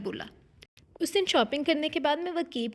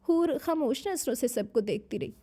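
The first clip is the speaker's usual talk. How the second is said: ordinary delivery, nothing marked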